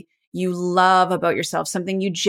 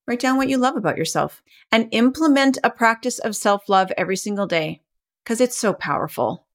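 ordinary talk

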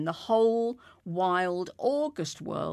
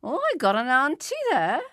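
high, quick, loud exclamation; delighted discovery; 6 semitones higher, 5 dB louder, faster than usual. A narrator's reading. Policeman learning where the drinkers come from, pleasantly surprised. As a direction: pipe up in sudden recognition. Policeman suddenly turns friendly.

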